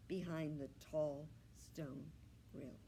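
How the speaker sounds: ordinary delivery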